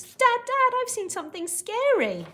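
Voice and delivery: high, loud, urgent cry to Dad; frightened shout. Acted. Boy Hogarth alerting his dad, shouting loudly. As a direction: shout it out in alarm. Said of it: "if I'm speaking loudly like Hogarth was".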